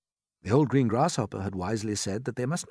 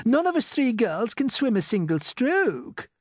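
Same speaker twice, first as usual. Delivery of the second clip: very high, louder worried reply; Miss Spider voice; 6 dB louder than usual. A narrator's reading. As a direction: chime in anxiously. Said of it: "said Miss Spider".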